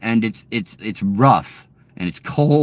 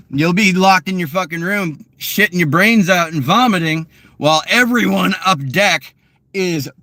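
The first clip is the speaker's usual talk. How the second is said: ordinary talk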